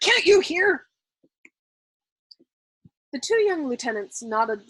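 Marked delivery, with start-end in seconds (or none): 0.0-0.9 high and loud impatient snap; snapped. A narrator's reading. Colonel snapping at the lieutenant, loud. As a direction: snap at him. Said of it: "Colonel Cathcart snapped".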